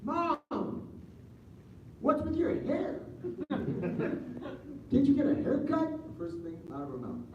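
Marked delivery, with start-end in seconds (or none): 0.0-5.9 high, slow, laughing disbelief; mock-indignant mom. Mother greeting her son, high and laughing. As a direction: squeal it through a laugh. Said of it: "I says".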